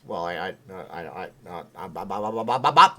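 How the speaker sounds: mock stammer building to a loud pop; imitated short-circuiting stutter; brain exploding. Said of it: "Then his brain exploded".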